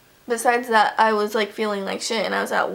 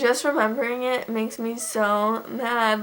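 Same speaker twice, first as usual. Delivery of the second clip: ordinary talk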